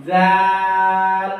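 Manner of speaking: জাল held very long and thin, tongue tip on the upper teeth; thin, drawn-out zal. Shown as a demonstration. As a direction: stretch জাল out slowly and keep it light. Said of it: "পাতলা করে উচ্চারণ করবেন". (টেনে টেনে পাতলা জাল)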